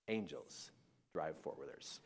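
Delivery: low, hushed awe; Cameron. Retold or imitated. Son repeats it the whole way home, hushed. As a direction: imitate a dazed kid murmuring very softly. Boy's dazed refrain going home.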